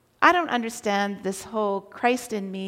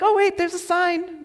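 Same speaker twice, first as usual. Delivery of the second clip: higher-pitched mock surprise; sudden discovery; 7 semitones higher, 3 dB louder than usual. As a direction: blurt it out as you spot something. Retold noticing of a sign.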